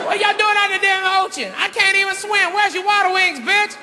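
high, incredulous scolding at the screen; heckling moviegoer. Acted. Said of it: "the brother started first".

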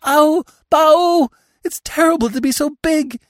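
very high, loud deer bleat; loud, piercingly high animal cries; hysterical bleating. A narrator's reading. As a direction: wail shrilly like a panicked fawn. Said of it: "bleating again".

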